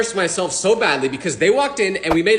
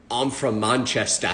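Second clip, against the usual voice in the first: put-on slow, lower, softer British accent; fake British accent; 9 semitones lower, 5 dB quieter, slower than usual. Bluffing to the stranger in a fake British accent. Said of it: "I kept pretending to be British".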